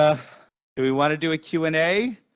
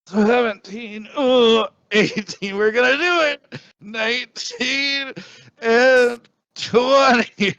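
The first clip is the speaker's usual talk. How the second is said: higher, strained counting with effort; straining; 10 semitones higher than usual. Real, not acted. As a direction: push out each count through strain. Straining through final push-ups.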